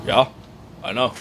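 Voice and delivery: minimal, effortless mumbled-off reply; no effort. Retold or imitated. Guest just sits there on the episode, effortless. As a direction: imitate a bored guest, low and soft. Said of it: "He doesn't need to put any effort in".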